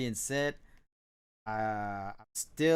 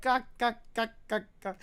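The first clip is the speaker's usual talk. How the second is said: mock cawing; 10 semitones higher than usual. Cawing reference sound.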